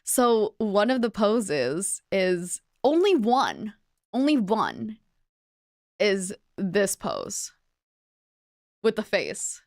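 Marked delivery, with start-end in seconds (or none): none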